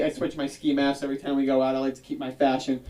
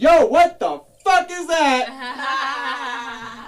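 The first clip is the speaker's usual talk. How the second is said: high, loud mock Jersey heckle; blunt Jersey guy; 9 semitones higher, 6 dB louder, slower than usual. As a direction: bust his chops like a Jersey guy. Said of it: "They're like".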